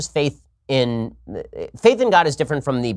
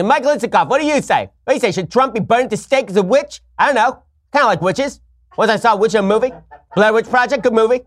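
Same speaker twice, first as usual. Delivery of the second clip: Chris Matthews impression; 8 semitones higher than usual. Host riffing as a cable anchor, high rambling voice. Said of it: "I enjoy doing Chris Matthews".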